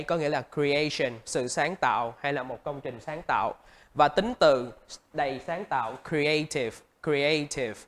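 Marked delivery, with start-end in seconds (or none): none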